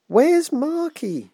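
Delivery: put-on high, slow, wailing child voice; small girl wailing for her lost doll; little girl crying out. A narrator's reading. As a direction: stretch it out like a frantic little girl. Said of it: "she cried".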